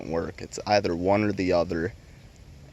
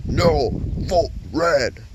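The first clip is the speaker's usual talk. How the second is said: drawn-out, higher mock voice, slow and loud; mocking commenter; 6 semitones higher, 5 dB louder, much slower than usual. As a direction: stretch it out like a smug troll. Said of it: "another guy comments".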